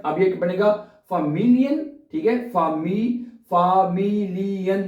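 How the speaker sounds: "familien" split as fa-mi-li-en, slowly; broken into syllables. Shown as a demonstration. Teacher showing how to split long German words into syllables. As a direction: break "fami-" off, then join into "familien". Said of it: "जितना आप तोड़ के पढ़ेंगे ना".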